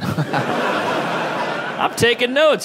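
slow, breathy laugh through the words; slow laughing confession; laughing aside. Real, not acted. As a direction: laugh while you say it.

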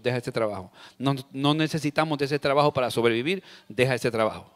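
low, soft flat commands; lightly voiced husband handing out advice; blunt fixer. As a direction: dismiss it like a know-it-all. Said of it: "opción número dos".

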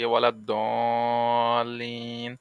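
slow, drawn-out Quranic recitation of ওয়ালা দলিন; imam's recitation. Retold or imitated. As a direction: chant ওয়ালা দলিন slowly like an imam in prayer. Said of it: "যেমন ইমাম সাহেব পড়েন".

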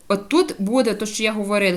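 ordinary delivery

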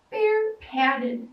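ordinary delivery

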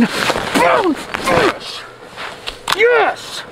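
very high excited yelps; triumphant yes. Real, not acted. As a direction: celebrate the measurement. Fish hits thirty-nine.

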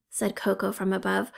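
usual speaking voice

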